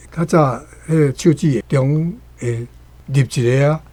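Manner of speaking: ordinary talk, nothing marked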